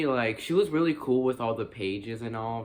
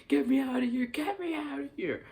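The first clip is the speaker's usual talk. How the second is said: very high-pitched mock panic; imitated scared Ash. Imitating Ash trapped in the cellar, high mock-scared squeal. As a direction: squeal it like someone badly faking terror. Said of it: "Ash is just so scared".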